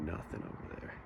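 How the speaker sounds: falling to a hushed whisper on "nothing over there". Real, not acted. Staring into the fog.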